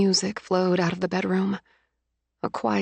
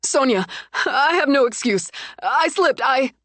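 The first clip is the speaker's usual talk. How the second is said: pleading excuse; 6 semitones higher than usual. Acted. Cheating fiancé pleading.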